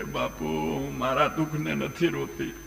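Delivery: hushed, tearful-wife role without sobbing; quietly acted woman's reply; softly spoken. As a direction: drop to a soft confiding voice. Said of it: "હળવે થઈને".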